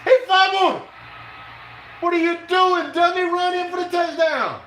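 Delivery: very high, loud screaming excitement; frantic shouting. Real, not acted. Fan screaming at a fumble on the last play, frantic. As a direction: shriek at the play as it unfolds.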